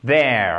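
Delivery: there with the tongue tapping the upper teeth; hard th. Shown as a demonstration. Teacher shows the hard th sound. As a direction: tap out the voiced th in there. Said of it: "ça tape un peu".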